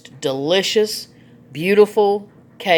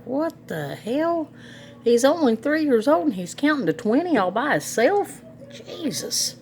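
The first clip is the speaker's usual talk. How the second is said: amazed disbelief; 7 semitones higher than usual. Retold or imitated. Grandma retelling her reaction to his counting, astonished. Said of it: "and I was like".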